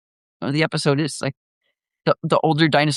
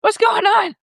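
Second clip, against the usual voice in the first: high, louder, baffled puppy; confused dog voice; 4 dB louder than usual. Retold or imitated. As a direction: voice the poodle puzzled. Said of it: "originally he's like".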